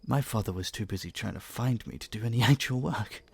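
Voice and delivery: laughing through the words. Acted. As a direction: chuckle as he gets to the punchline. Laughing while telling the story.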